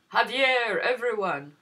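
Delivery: hearty host calling everyone over. Acted. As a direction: call the guests over. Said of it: "Señor Gordillo said".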